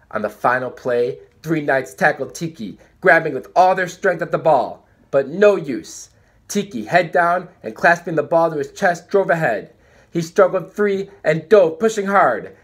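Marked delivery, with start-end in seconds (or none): none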